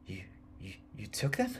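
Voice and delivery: hushed stammer rising high; falter, then ask in disbelief; horrified stammer. Acted. Elliot to the old woman about the teeth, stammering in horror. Horrified man questions her.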